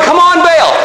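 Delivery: high, straining call to a god; mock yelling. Baal's prophets keep on yelling to their god, high desperate call. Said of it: "keep, keep yelling".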